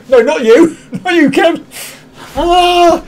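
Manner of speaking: high, laughing through the words; laughing denial. Real, not acted. Laughing off the joke to Kev, high and giggling. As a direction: crack up while saying no. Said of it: "I dropped myself in that one then".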